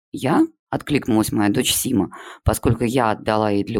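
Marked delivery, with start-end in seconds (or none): 0.1-0.5 very high, startled "Я?"; surprised yelp. Acted. A pupil answering the teacher, startled high "Я?". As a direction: jump up in pitch, caught off guard. Girl answers to her mother's name.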